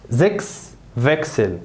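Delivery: "زكس فيكسل" slowly, zeks veksel; slow model words. Shown as a demonstration. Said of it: "يبقى زكس فيكسل".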